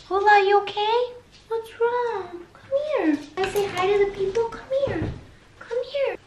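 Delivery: high-pitched, soft pet-owner coaxing; coaxing dog talk. Real, not acted. Owner calls her crying dog over, high coaxing voice.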